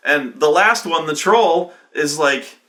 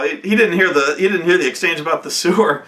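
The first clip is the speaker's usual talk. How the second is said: usual speaking voice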